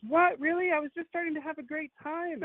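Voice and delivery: high-pitched mock disappointment; protesting date. Date told they are leaving, mock protesting. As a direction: mimic her whining protest way up high.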